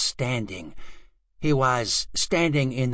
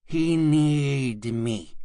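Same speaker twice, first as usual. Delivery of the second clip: ordinary delivery, nothing marked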